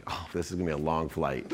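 low, soft resigned inner voice; weary aside. Retold or imitated. Passenger stuck beside a meditation advocate, wearily resigned. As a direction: drop it to a dreading aside to yourself. Retold weary thought on plane.

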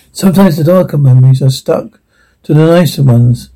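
ordinary talk, nothing marked

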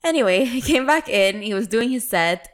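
ordinary talk

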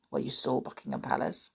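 fast unvoiced whisper; whispered aside. Real, not acted. Aside correcting herself.

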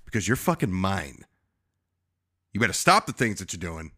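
low, quiet possessive warning; understated menace, soft and low; imitated conviction. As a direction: pause between phrases, low and dead certain. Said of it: "the conviction she uses".

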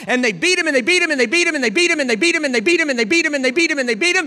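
high, loud, rapid-fire preaching; shouted, driving litany; relentless pounding. Real, not acted. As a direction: hammer each repetition like a blow. Beating repeated at full force.

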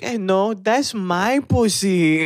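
high, slow mock mother; hammed-up mom. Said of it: "your mom's like".

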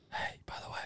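breathy secret whisper. Pretend. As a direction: lean in and whisper it. Whispered tip to princess.

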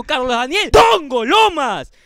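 Dongo Lomas slow and shouted; emphatic slow announcement, big hit on Dongo Lomas; showing how to read. Shown as a demonstration. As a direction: punch out Dongo Lomas, drawn out. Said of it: "Acá te digo".

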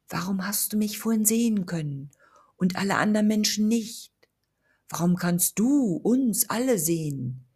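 a lost spirit begging for help in a hushed, airy voice; whispering ghost. Acted. Ghost voice held over many lines.

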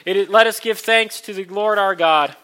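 loud call to lift hearts; liturgical call. Acted. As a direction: proclaim it to the congregation.

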